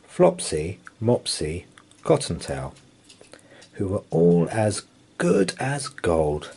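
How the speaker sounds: usual speaking voice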